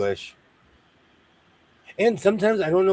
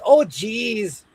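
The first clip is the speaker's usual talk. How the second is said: Minnesota "oh geez"; 6 semitones higher than usual. Retold or imitated. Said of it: "anyone who says".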